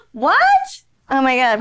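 real disbelief; shocked excitement; surprised. Real, not acted. Shocked by the K thing.

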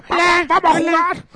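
put-on high, excited little kid; child voice. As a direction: squeal it like a small child. Imitating the kid from the short.

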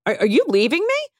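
high, hammed-up hurt question; mock betrayed. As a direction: play the abandoned client. Dramatic accusation to tailor.

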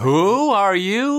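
high, loud, slow indignant shout; mock shout. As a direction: yell it from the crowd, baffled. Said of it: "I really wish somebody was like".

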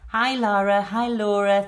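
ordinary talk

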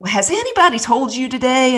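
high-pitched Southern lady's question; attempted Southern accent. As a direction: imitate a sweet Southern lady, pitched high. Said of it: "can't even do her Southern accent".

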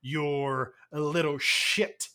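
slow, low, deliberate action hero; Sly impression. Hero on the store P A to a robber, slow Sly impression. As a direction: draw each word out, low and menacing. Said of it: "It's a pretty good sly kind of thing".

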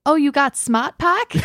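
put-on slow, broad Boston accent. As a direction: drop the r's, Boston style. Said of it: "yell at each other in Boston accents".

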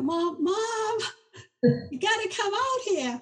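high, pleading daughter's call through laughter; laughing urgent call. Retold or imitated. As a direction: play the giggling, desperate daughter. Daughter voiced, calling mom out.

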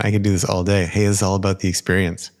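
ordinary talk

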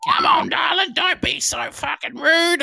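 very high falsetto imitation of a partner; Marge. Partner chiding her man for rudeness, falsetto impression. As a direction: squeal it in a put-on falsetto, slowing at the end.

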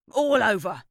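angry neighbour answering mum, crying out loud. Acted. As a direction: cry it out in outrage. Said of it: "Mister McCarthy cried".

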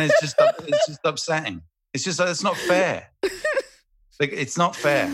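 high-pitched aggrieved protest; mock-outraged whine at an injustice; comic indignation. Real, not acted. Comic complaint about trapped smell.

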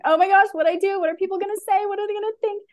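voicing her own panic attack to the host; mock panic. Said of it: "have that panic attack".

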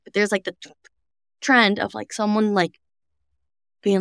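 ordinary delivery

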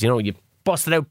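usual speaking voice

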